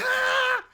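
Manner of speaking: high, loud overcaffeinated scream; short wired shriek; jazzed scream. Acted. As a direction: scream like a bee on too much coffee. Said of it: "way too jazzed".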